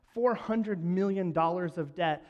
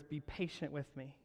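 softly remembered plea; 2 semitones lower, 11 dB quieter than usual. Acted.

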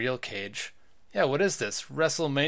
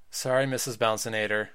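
ordinary delivery

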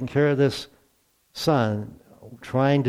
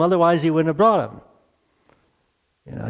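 usual speaking voice

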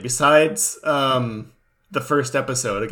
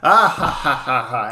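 maniacal laugh; 4 dB louder than usual. Retold or imitated. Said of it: "maniacally laughing".

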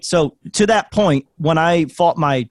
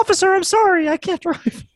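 mock helpless plea; 12 semitones higher than usual. Mock pleading to police officer.

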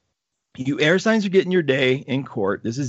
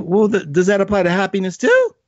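cheeky question; 8 semitones higher than usual. Retold or imitated. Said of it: "I was like".

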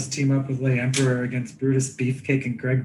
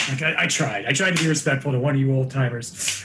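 normal talking voice

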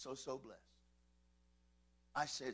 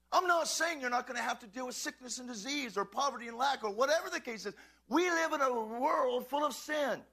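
raised, emphatic sermon voice; preach; 6 semitones higher, 8 dB louder than usual. Real, not acted. Pastor declaring victory over the devil, fervent preaching. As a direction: drive each phrase home to the congregation.

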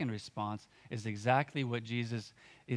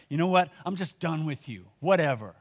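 higher and louder, a fed-up brush-off; a hammed-up huffy "whatever"; mock dismissive outburst; 4 semitones higher, 7 dB louder than usual. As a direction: toss it off like you're washing your hands of them. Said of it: "It's not wrathful".

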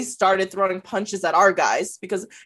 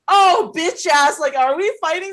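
angry challenge; 7 semitones higher, 3 dB louder than usual. Retold or imitated. Confronting guy who threw beer.

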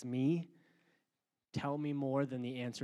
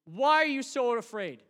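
high, loud, pleading voice of Jesus; Jesus rebuking; 11 semitones higher, 4 dB louder than usual. Acted. Jesus challenging his frightened disciples, high and loud. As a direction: press the disciples with each question. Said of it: "Jesus looked at the disciples and says".

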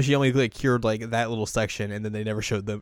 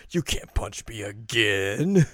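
slow, drawn-out taunting cartoon villain; mock villain; much slower than usual. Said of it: "He's like".

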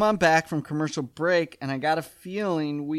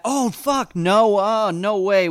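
high, loud burst of objection; excited protest; 6 semitones higher, 5 dB louder, slower than usual. Real, not acted. Co-host stops the recap before a favourite line, excited protest. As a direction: jump in urgently, pitch leaping up.

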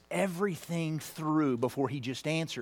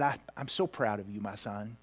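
soft, low and much quieter, almost breaking; tender hush; 9 semitones lower, 10 dB quieter than usual. Retold or imitated. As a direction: say it close, like a father holding his son. Father hugging son, tender words.

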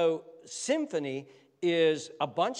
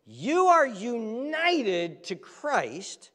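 very slow, weighty delivery; slow emphasis; 6 semitones higher, 4 dB louder, much slower than usual. Acted. Preacher stressing union with Christ to hearers, very slow. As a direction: lean on every word, slowly.

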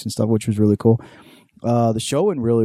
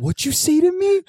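very high mock challenge; imitated drunken yelling. Other drunk brother answering back, mock yelling. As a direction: squeak it out as an affronted drunk. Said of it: "they just started yelling at each other".

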